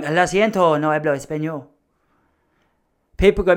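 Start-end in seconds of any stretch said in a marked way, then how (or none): 0.0-1.7 light put-on Spanish twang; a touch of Spanish accent. Shown as a demonstration. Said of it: "a twang".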